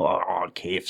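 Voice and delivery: ordinary talk, nothing marked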